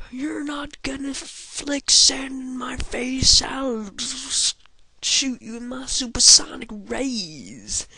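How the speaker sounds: high, loud, slow taunting creature voice; cocky Pokemon threat. Pretend. Player voicing Inko taunting Sandshrew, high and loud. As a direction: taunt like a bratty little monster.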